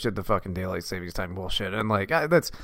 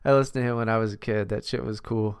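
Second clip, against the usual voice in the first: usual speaking voice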